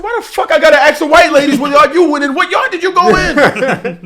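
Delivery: high, loud, indignant demand; irate retold argument. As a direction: act out hollering at the driver in disbelief. Retells arguing with the delivery driver.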